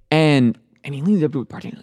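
ordinary talk

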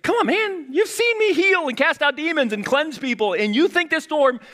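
Jesus scolds doubting disciples after the storm, exasperated and high. Retold or imitated. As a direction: play Jesus fed up with his disciples, high and loud.

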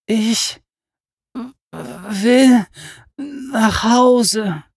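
high, broken sobbing plea. Acted. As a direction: sob out each word. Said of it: "schluchzte sie".

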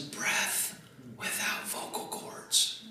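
slow, voiceless whisper. Shown as a demonstration. Preacher demonstrating the dictionary definition of a whisper, whispering. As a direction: drop to pure breath, drawing the words out. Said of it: "To speak very softly using one's".

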